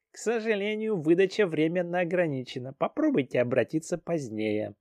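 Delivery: high, prim customer-service voice; polite clerk voice. Acted. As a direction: recite it like a polite robot clerk. Hologram clerk answers politely.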